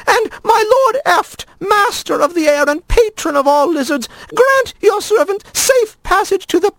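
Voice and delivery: a nervous lizard invoking gods; lizard praying. A narrator's reading. Lizard prays before crossing.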